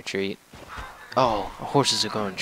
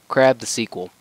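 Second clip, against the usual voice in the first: louder proud naming; mock grand title; 6 dB louder than usual. Gamer naming his desert, mock proud announcement. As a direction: proclaim the name with gusto.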